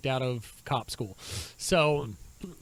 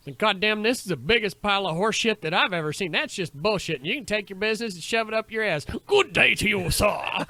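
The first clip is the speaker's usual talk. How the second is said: loud, higher hammed-up Texan rant; thick Texas-Yankee accent; 5 semitones higher, 5 dB louder than usual. Walking out of a shop, thick mock accent. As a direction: pull out your thickest accent. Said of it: "pull out my thickest".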